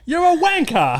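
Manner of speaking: high, loud mock English accent; shouted English-accented insult. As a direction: yell it in a hammed-up English accent. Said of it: "plugs in an English accent".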